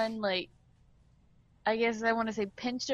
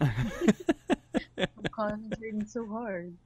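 mock straining; much slower than usual. Straining joke.

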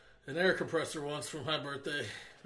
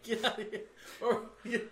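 usual speaking voice

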